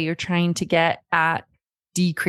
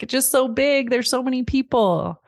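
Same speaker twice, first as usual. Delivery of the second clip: overwhelmed son; 6 semitones higher than usual. Retold or imitated. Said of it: "He was like".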